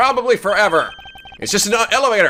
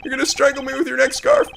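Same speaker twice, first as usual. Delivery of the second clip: panicked passenger voice; 11 semitones higher than usual. Acted.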